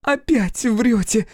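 high, drawn-out tearful accusation; sobbing. A narrator's reading. Fiona strikes Crow's chest and accuses him, sobbing. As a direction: cry it slowly through a sob. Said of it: "всхлипнула она".

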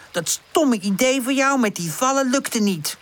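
high, cross jab; angry accusation. A narrator's reading. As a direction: blame your friend mid-quarrel. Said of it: "Woezel kijkt boos naar haar om".

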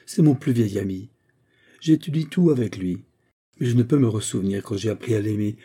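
ordinary delivery, nothing marked

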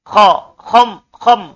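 khom with a rasping throat kh, not an h; Arabic kh drilled in khom; kh sound in khom. Shown as a demonstration. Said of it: "Bukan ham".

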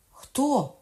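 high, loud one-word demand; sharp cry. A narrator's reading. Queen mother calls to the guards, sharp cry. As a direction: cry out the question. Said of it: "скрикнула королева мати".

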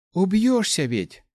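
high, slow exclamation of concern; exasperated scolding. A narrator's reading. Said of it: "вздохнула молодая магиня тьмы".

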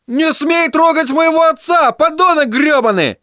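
высокий мальчишеский крик; ори, защищая отца; орал. Acted. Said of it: "орал Тедди". (Тедди орёт на Майло)

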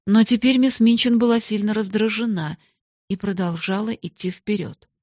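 usual speaking voice

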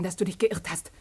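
ordinary talk

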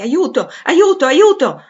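high, loud cries for help; grido d'aiuto. A narrator's reading. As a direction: shout desperately for rescue, voice leaping up. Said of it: "Una voce soffocata gridò tre volte rapidamente". (grido disperato d'aiuto)

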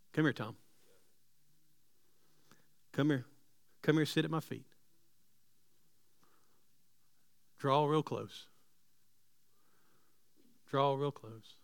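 soft, low invitation; God's gentle call. Acted. God softly calling Tom to sit at his feet. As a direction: draw him close tenderly. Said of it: "hear the voice of God saying".

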